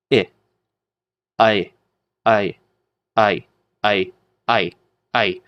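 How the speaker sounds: "I" built from ah and ee, run together; blended diphthong. Shown as a demonstration. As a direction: repeat "I" as ah gliding into ee. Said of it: "that kind of blends into I".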